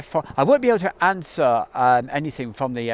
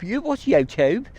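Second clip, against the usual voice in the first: mock Suffolk accent, pitched higher, with YouTube said as yoh-toob; bad local accent; 7 semitones higher than usual. Teasing how the locals say YouTube, a bad mock Suffolk accent. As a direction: tease the Suffolk way of saying YouTube. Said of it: "that's a very bad accent".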